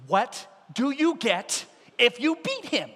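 spelled out for slow listeners; emphatic repeat. Acted. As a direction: repeat it with deliberate spacing. Repeats it after they say what.

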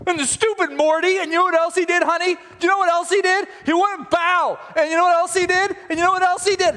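high, loud ranting to his wife; comic outraged tirade at high pitch; hammed-up fury. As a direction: rant like a furious, petty husband. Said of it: "He's so furious".

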